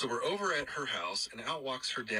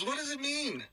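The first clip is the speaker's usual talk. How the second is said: high-pitched Irish question; Irish accent. Retold or imitated. Roommate questions the name's meaning, high Irish accent. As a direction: ask it as an imitated bewildered Irishman. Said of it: "pretty good job with that accent".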